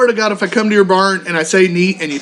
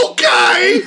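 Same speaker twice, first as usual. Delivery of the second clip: mock shouted okay; 12 semitones higher than usual. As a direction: shout it back, laughing. Umpire shouting back at coach.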